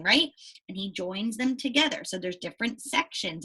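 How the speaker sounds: ordinary talk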